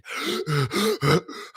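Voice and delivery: acted heavy gasps for air; winded, hammed-up panting; exhausted gasping. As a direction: pant like a worn-out fighter between rounds. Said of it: "You're like that".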